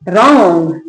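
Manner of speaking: रॉन्ग drawn out, begun straight on R; silent W. Shown as a demonstration. Teacher showing learners the reading, रॉन्ग with silent W. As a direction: model रॉन्ग with no W sound. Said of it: "कैसे पढ़ेंगे".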